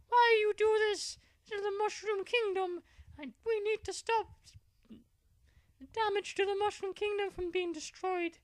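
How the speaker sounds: squeaky high cartoon voice, shouted; angry Mario. Mario yells at the villain in a squeaky high voice. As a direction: shriek it like an outraged cartoon plumber. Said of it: "Mario was really angry".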